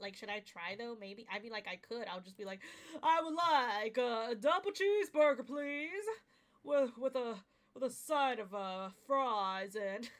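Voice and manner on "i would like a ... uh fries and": ordering food in a put-on voice; voice acting voice. Shown as a demonstration. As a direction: fake character voice, ordering. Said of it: "in this voice".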